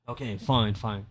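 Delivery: low, soft, reluctant fine; grudging fine. Retold or imitated. Grudgingly agreeing to share Instagram, reluctant. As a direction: give in grudgingly, trailing off. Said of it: "I think I said fine".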